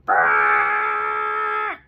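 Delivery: low, loud buzzing horn; horn blast. Acted. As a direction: blast like a big truck horn. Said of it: "a firefighter presses the horn".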